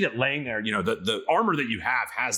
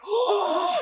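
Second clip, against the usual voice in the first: sharp gasp then a much higher "Oh"; amazed gasp. Acted. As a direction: gasp in wonder, then squeal it. Shopkeep amazed by armor.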